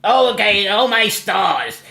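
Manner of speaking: high, loud mocking whine; hammed-up impression of a petulant guy; mocking Hussey. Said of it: "And he says".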